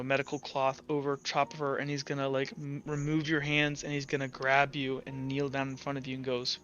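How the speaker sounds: ordinary delivery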